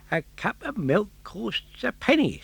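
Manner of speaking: a lightly voiced farmer driving a bargain; Giangio the gardener. Gardener Giangio states price.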